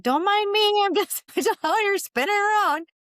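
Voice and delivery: brightly fake-breezy while spinning in circles; sarcastic mock cheer. Spinning kayak on anniversary, to passing people, sarcastic high laugh. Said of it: "I'm like".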